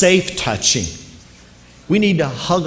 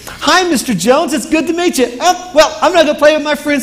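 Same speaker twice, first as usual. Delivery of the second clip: put-on much higher, louder chirpy child, fast at the end; cheerful little girl; 13 semitones higher, 6 dB louder than usual. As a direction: bounce through it like a six-year-old. Little girl greets the boss.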